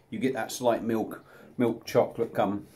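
normal talking voice

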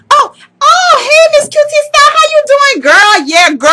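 high-pitched, squealed over-sweet greeting; fake gushing. Caught badmouthing Miss Q T Style, high fake-friendly gushing. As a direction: gush in a high voice as if caught talking behind her back. Suddenly gushing to the person just mocked.

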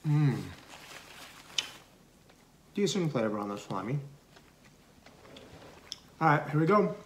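talking through food; muffled chewing speech; mouth full chewing. Real, not acted. Seen 0: Food put in mouth at -5; cheeks bulge while speaking.

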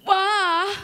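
loud, high "Why?" flung at the sky; anguished cry. Retold or imitated. Lying in the dirt, crying out to God, loud and high. Angry night outside, crying out.

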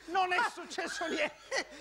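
slow, high-pitched insistence; drawn-out high protest; insistent denial. Acted. Keeps denying, laughter around.

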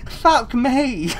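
laughing relief. Real, not acted. Gamer after surviving the chase, laughing disbelief. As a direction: swear through a laugh.

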